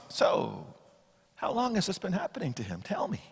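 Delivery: soft, unhurried, almost murmured questions; casual quiet questions. Acted. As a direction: ask like there's no rush at all. Jesus calmly questions the father.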